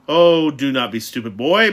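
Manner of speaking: scolding. A narrator's reading. Miss Watson scolds the boy for playing stupid, loud. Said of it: "Miss Watson scolded".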